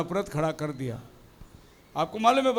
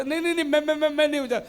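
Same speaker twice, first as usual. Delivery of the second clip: higher, flustered stammer; stammering denial; 4 semitones higher, faster than usual. Acted. The seller stammering his innocence, pitched higher. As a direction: stumble over मैं repeatedly. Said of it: "दोनों तोतला है".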